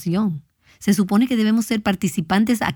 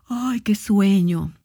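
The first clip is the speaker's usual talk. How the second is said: slow, sighing tired voice; playfully weary and drawn out; mock sleepiness; slower than usual. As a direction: sigh it out as if too drowsy to bother. Acts out being too sleepy to sing.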